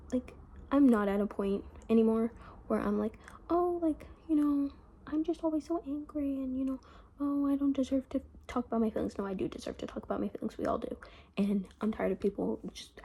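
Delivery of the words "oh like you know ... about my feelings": higher, mildly whiny self-parody; mock self-pity. Not at a point anymore of doubting herself, mock self-pity. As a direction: put on a poor-me voice, pitched up.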